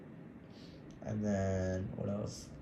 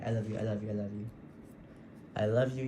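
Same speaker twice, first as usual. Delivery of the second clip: usual speaking voice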